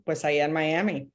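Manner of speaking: normal talking voice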